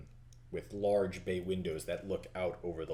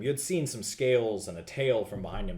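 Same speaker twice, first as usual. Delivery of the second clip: ordinary talk, nothing marked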